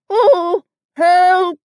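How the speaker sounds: high, loud, tearful wail; muffled cry for help. A narrator's reading. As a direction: plead for rescue from inside the jar. Pooh stuck in jar.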